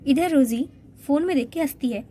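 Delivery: ordinary talk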